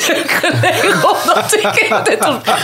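laughing while telling it. Real, not acted. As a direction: break into laughter mid-sentence. Funny sleepwalking story, laughing.

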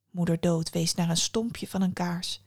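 usual speaking voice

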